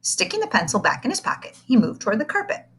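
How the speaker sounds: plain reading voice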